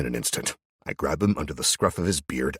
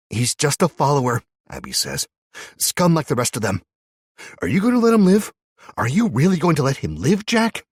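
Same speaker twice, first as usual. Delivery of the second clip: pressing demand; 11 semitones higher, 8 dB louder than usual. Acted. Abby urging Jack to kill the captive, rising fury. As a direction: voice climbing high and loud, pushing hard.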